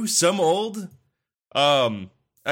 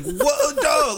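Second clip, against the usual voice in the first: excited shout; 13 semitones higher than usual. Real, not acted. Reacting to brutal weapon.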